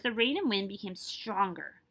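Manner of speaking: plain reading voice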